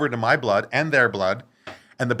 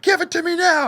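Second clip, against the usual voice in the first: much higher, insistent demand; high demanding yell; 4 dB louder than usual. Retold or imitated. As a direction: yell it at him. Dealer repeats the demand.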